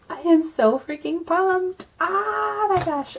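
high, loud excited squeal; bursting with real excitement. Real, not acted. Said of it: "I'm just so excited".

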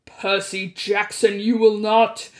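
slow, loud, looming threat; menacing god of the dead. A narrator's reading. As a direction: bear down on him like a furious god. Hades threatens Percy.

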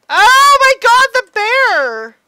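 high, loud shocked outburst; shocked cry. Real, not acted. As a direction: gasp out in horror. Shock at bear.

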